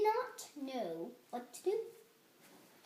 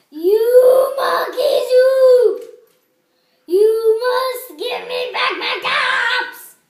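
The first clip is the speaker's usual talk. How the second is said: very loud, high, slow singsong shout; 10 semitones higher, 20 dB louder, slower than usual. Acted. Peddler stomps both feet and shouts at the monkeys, very loud high singsong. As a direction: roar it out, stretching every word.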